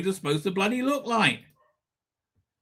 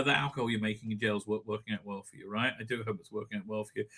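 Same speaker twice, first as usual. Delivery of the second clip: sarcastic mutter; 11 semitones lower, 11 dB quieter, faster than usual. Real, not acted. Sarcastic jab at [name].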